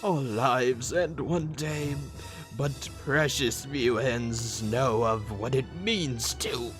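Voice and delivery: solemn booming pronouncement; grand dramatic declamation. Acted. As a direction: declaim it slowly and loudly. Dramatic villain side declamation.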